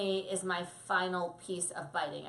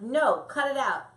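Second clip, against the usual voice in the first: loud, higher scold; 6 semitones higher, 9 dB louder than usual. Retold or imitated. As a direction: snap no at the puppy. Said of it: "they're like".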